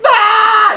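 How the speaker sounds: high, loud retold scream; sudden full-voiced comic shriek; scream in face. Caught boy answering the homeowner's question, high loud scream. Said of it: "my response was to scream in his face".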